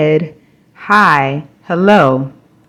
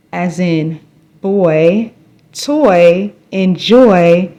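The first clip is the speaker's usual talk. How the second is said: normal talking voice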